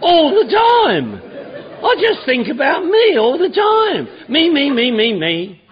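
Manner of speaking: high, loud laughing admission. Real, not acted. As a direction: laugh through it, delighted at yourself. Laughing confession of self-obsession.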